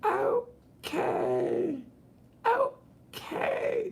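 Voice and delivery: very high squeaky drawn-out okay; mock a sweet LA girl; valley girl okay. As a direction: falsetto okay. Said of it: "It's like".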